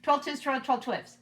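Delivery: fast. Shown as a demonstration. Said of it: "Can you do it faster?".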